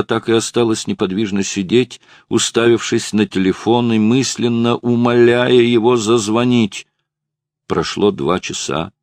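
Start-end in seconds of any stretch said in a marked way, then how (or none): none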